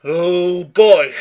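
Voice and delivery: exasperated, mocking outburst; sarcastic exclamation. Real, not acted. Reacting to the word "fundies.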